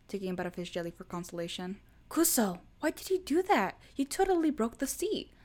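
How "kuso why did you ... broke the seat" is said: high, loud squeaky complaint; hiss-shout it in embarrassment; flustered protest. A narrator's reading. Scolding his boyfriend in the cafe, high and loud.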